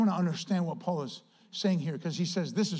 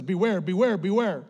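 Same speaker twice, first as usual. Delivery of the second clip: ordinary delivery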